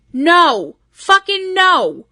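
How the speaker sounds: loud, high, furious no; angry outburst. Real, not acted. Angry at the fan who grabbed a wrestler, high and loud. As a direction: rage at the fans who grab wrestlers.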